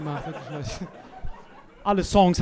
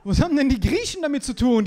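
usual speaking voice

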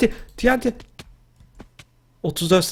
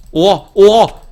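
loud, higher startled outburst; shocked shout; 8 semitones higher, 8 dB louder than usual. Real, not acted. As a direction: exclaim in alarm. Shocked by rival in game.

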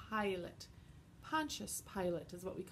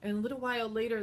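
ordinary delivery

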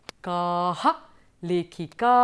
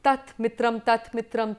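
ordinary talk, nothing marked

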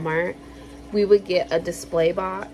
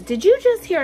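wife asking; 12 semitones higher, 6 dB louder than usual. Retold or imitated. Wife startles her.

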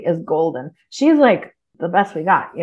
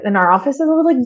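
ordinary talk

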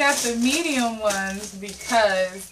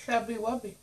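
cheeks stuffed full; 8 dB quieter, faster than usual. Real, not acted. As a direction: mouth crammed with marshmallows. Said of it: "you put one marshmallow in your mouth".

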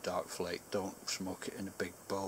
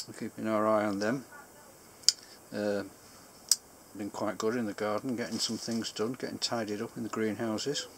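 talking around pipe stem; speaking with clenched teeth; pipe in mouth; 2 semitones higher, 4 dB louder than usual. Real, not acted. Seen 1: Pipe stem clenched in teeth throughout; out of mouth before and after.

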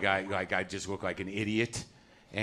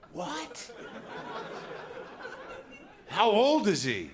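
high, slow shocked question; amazed child; 12 semitones higher, much slower than usual. Retold or imitated. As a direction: gasp it like a stunned first grader.

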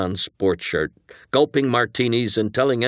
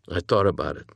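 ordinary delivery

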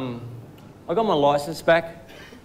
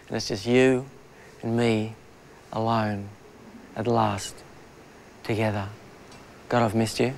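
softer and lower, a hammed-up lover's murmur; mock romantic; 3 semitones lower, 6 dB quieter than usual. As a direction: drop the voice as if finally alone with a sweetheart. Mock-romantic address to the audience.

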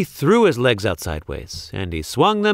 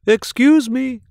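high little cricket voice, clearing its throat; small cricket interrupting with a cough; polite cricket; 12 semitones higher, 4 dB louder than usual. A narrator's reading. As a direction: pipe up politely like a tiny insect. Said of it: "coughed a cricket".

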